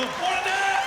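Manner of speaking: high, loud chirpy greeting; cheerful teen son. Retold or imitated. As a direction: bounce it up brightly. Son voiced cheerfully in story.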